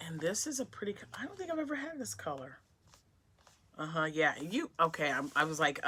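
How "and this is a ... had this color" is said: soft, quick whisper rising higher; hushed admiration. Real, not acted. Finding a colour she never had, soft and higher. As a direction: murmur it, delighted and hushed.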